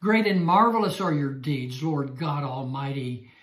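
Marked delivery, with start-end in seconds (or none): none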